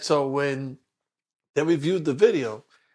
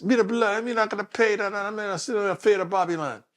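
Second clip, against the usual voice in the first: imitated Jamaican rider, pitched up; Jamaican accent; 4 semitones higher than usual. Retelling a rider refusing the fare, imitated Jamaican accent. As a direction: put on a Jamaican man's protest. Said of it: "he's a Jamaican guy".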